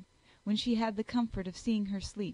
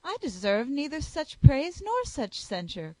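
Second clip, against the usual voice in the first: cried out; 5 semitones higher, 7 dB louder than usual. Acted. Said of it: "cried Elizabeth".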